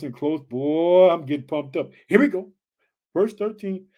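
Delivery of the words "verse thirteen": ordinary talk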